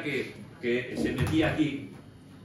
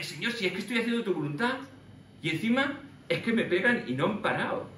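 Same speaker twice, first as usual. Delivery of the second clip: higher, aggrieved plea to the Lord; complaining prayer; 7 semitones higher than usual. Pretend. Preacher pretending to be beaten Paul, complaining aggrieved. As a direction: protest that the beatings never stop. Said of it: "Yo diría".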